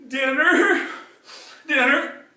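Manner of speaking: put-on whiny child. Hungry grandson demanding dinner, high and loud. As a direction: whine it like a hungry five-year-old. Five-year-old whining for dinner.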